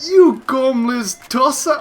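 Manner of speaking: high, slow, hammed-up English accent; mock English insult. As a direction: spit it out like a cartoon Brit. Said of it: "something that I would call a".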